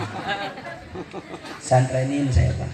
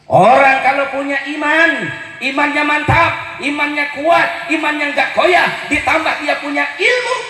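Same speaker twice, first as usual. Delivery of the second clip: high, loud, climbing sermon cry; fiery preaching; 8 semitones higher, 3 dB louder than usual. Acted. Preacher to congregation on faith, full-force preaching. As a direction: lift the congregation with rising force.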